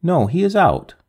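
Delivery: a mild mock scream of a reply; answering the tramp with a touch of a scream; narrated scream. A narrator's reading. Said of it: "I screamed in reply".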